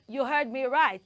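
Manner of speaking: ordinary talk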